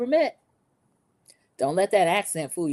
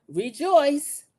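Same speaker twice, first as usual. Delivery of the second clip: dramatic host voice; 8 semitones higher than usual. Retold or imitated. Recapping the show, mock grand host proclamation. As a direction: mimic a theatrical game host, high and grand.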